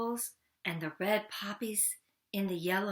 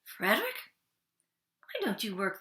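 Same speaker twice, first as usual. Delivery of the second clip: mice asking; faster than usual. Acted. Mice question Frederick.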